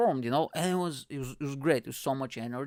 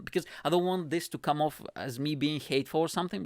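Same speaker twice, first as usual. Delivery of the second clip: ordinary talk, nothing marked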